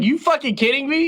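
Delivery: high incredulous outburst, rising at the end; retold anger. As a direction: act out being mad and in disbelief. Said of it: "I was mad. I was like".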